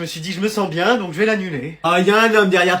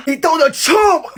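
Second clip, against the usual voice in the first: shocked outcry; 8 semitones higher, much slower than usual. Acted. Shocked parent.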